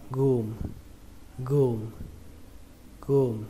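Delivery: "gum" with a long "oo" and silent B. Shown as a demonstration. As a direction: say "gum" as "gu" plus M. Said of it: "chúng ta sẽ đọc là âm U". (đọc "gum" như "gu" thêm âm M, bỏ âm B)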